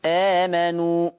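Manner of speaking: long, held first vowel in آمنوا; madd al-badal. Shown as a demonstration. Teacher showing students madd al-badal, آمنوا with drawn-out first vowel. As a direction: stretch the opening of آمنوا in slow recitation. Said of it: "مد البدل".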